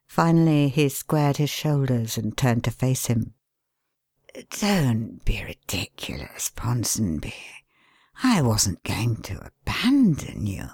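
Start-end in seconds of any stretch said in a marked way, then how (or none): 4.6-10.7 soft, hushed and kindly; kindly whisper. A narrator's reading. Pale man calms the hysterical ex-mayor, in a kindly whisper. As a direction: soothe him in a whisper. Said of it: "with a kindly tone".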